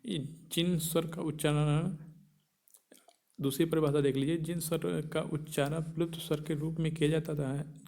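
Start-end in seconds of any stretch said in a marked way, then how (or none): none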